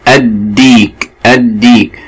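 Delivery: "الديك" with the lam unspoken, straight into a doubled d; silent lam. Shown as a demonstration. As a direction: draw out "الديك" as "ad-diik", holding the d. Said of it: "فاللام لا تنطق". (نطق "الديك" بلام شمسية لا تنطق ودال مشددة)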